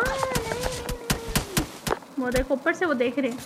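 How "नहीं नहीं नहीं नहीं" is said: high, drawn-out pleading no, no; alarmed protest. Real, not acted. Streamer under fire in the game, high drawn-out alarmed no. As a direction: cry out as the enemy gets you.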